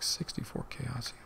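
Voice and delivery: breathy whispered count; hushed disbelieving whisper. Real, not acted. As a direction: whisper the number to yourself.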